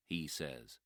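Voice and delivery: ordinary delivery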